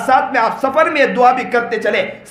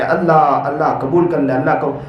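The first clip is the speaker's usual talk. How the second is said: tearful prayer; 7 semitones lower than usual. Retold or imitated. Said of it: "روتے روتے میں سجدے میں گر جاتا ہوں". (ہلکی روتی ہوئی عاجزانہ دعا)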